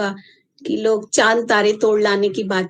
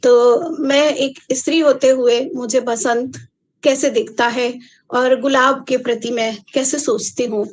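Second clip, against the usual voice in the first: normal talking voice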